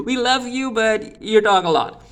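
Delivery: high-pitched, laughing through the words; laughing tease. Real, not acted. Amused aside teasing a character.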